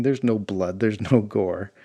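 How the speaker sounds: plain reading voice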